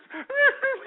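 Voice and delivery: high whimpering fake sob; a hammed-up wail between words; mock sob. Mocking the crying victim, fake high sob. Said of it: "she was sitting there crying".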